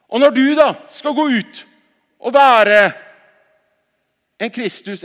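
loud, high ringing preacher's call; forceful preaching. Acted. Pastor rousing the congregation to go out, forceful preaching. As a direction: proclaim it to the whole hall.

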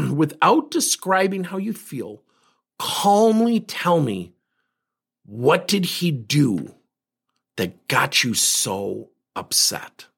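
slow, measured and soothing, with gentle pauses; calm coaching voice. Retold or imitated. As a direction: talk her down slowly, like easing someone off a ledge. Coaching upset friend to calm down.

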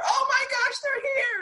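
very high, squealing mock panic; panicked shriek. Hypnotized girl fleeing the British lady, high panicked squeal. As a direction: shriek like the enemy just showed up. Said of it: "she freaks out".

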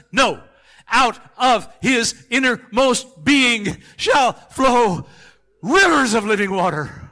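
building to a very loud high shout; full-force preaching. Acted. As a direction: climb until you shout it, slow at the peak.

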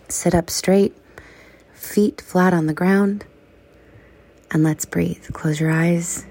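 soft, unhurried meditation guide with whispered lines; calm breathing-exercise voice; guided meditation. Acted. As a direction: ease listeners gently through the body scan. Leads a breathing body scan.

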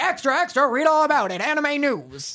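put-on old-time newsie shouting headlines; rapid old-time reporter. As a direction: call it out like a street paperboy. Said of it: "someone's like".

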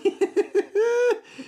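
bright burst of high laughter. Real, not acted. Driver laughs about craving crazy rides, high laugh.

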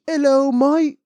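put-on high, drawn-out caricature British accent; hammed-up high-pitched British accent; mock British accent. Ridiculing how funny Brits sound, mock British accent. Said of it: "funnier than a fucking British accent".

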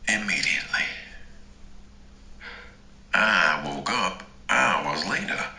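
usual speaking voice

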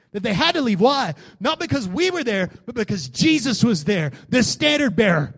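high-pitched preaching building to a loud shout; impassioned, swelling sermon climax; fired-up preaching. Real, not acted. Preaches at rising full force.

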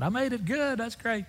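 high, excited imitation of a caller; Sam's happy voice. Sam phoning his friend after the bus trip, happily excited. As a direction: pitch it way up, innocently pleased.

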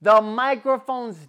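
high, loud exasperated outburst; worked-up storyteller's rant; retold seething rage. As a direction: relive the fury at full volume. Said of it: "I'm seething, bro".

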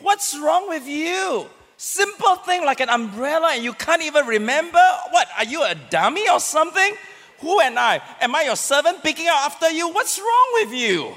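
hammed-up irritated spouse berating a forgetful partner; nagging scold. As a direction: scold the spouse like a fed-up servant. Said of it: "we don't talk like this to a friend".

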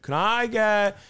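high, slow, dazed order; tripping customer at the drive-thru; spaced-out customer. Retold or imitated. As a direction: drift up high and slow, totally out of it. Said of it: "they're just like".

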